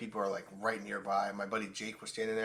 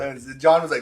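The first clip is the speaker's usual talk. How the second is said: usual speaking voice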